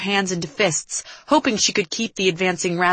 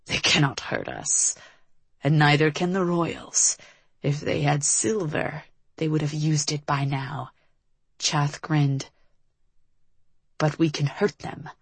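low, scornful villain voice; snorting villain; 3 semitones lower, 4 dB quieter than usual. A narrator's reading. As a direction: snort it with quiet contempt.

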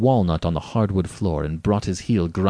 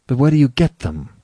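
quick, higher, louder question; urgent challenge; 5 semitones higher, 7 dB louder than usual. Acted. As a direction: demand an answer. Rainsford challenges the General.

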